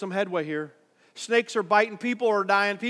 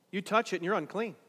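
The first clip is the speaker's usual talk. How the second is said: ordinary delivery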